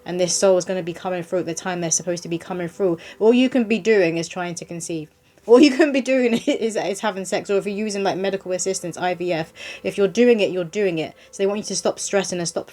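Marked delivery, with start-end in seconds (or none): 5.4-6.8 higher, louder, breaking into a laugh; amused, raised voice with a chuckle. Real, not acted. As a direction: laugh as you say it. Laughs as she repeats herself.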